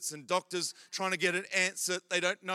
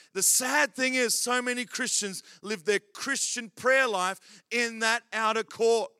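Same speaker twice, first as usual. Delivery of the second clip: usual speaking voice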